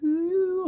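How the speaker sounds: high thin imitated feedback ring; feedback whine. Musician copying stage feedback to a host, high whine. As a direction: mimic the squeal of a ringing monitor. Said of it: "things start going like".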